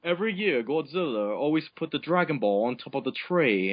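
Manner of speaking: ordinary delivery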